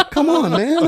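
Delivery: high, loud plea broken by laughter; laughing plea. Retold or imitated. Urging scared coworkers to follow him, laughing loudly. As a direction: beg them to come while laughing.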